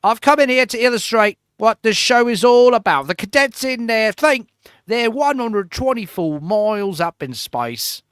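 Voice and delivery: hammed-up Australian TV host voice; mock Australian host. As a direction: do the host with a drifting Australian accent. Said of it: "It just always becomes Australian".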